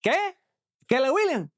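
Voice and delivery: put-on high, puzzled Spanish-accented "¿Qué? Keller Williams?"; Spanish speaker confused. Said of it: "try saying Keller Williams in Spanish".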